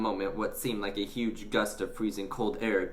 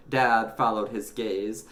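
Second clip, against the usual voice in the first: usual speaking voice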